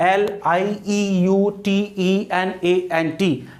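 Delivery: slow letter-by-letter dictation; measured classroom spelling; spelled out slowly. Shown as a demonstration. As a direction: give each letter its own space. Spelling out word for students.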